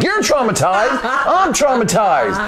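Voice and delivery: high-pitched mock outrage; indignant retort. Mark answering his traumatized boss, indignant.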